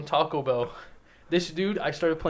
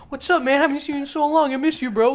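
friendly greeting; 9 semitones higher than usual. Retold or imitated. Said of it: "he's like".

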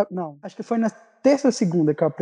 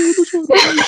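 high, loud tearful wail; hammed-up sobbing voice, much higher; imitated crying; 11 semitones higher, 5 dB louder than usual. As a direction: mimic bawling down the phone.

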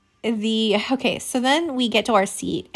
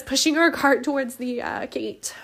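usual speaking voice

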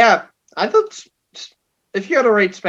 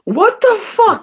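high, confused wrestler voice; mock Murphy; 9 semitones higher, slower than usual. Murphy baffled watching the match from ringside, high voice.

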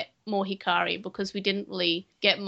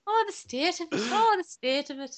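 high-pitched mock Irish lilt; exaggerated fake Irish accent; crap Irish accent; 7 semitones higher than usual. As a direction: put on a silly stage-Irish voice. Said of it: "really think Irish people sound like that".

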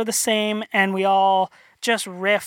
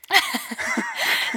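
breathy laugh; 9 semitones higher than usual. Real, not acted. Chuckling to the host about loving being hazed. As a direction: laugh at how much fun the hazing was. Laughs after hazing story.

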